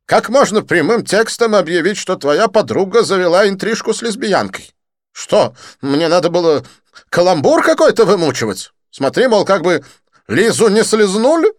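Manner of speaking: high, loud, theatrical French friend; excited Didier. Acted. Didier greeting his friend Lin, high excited gush.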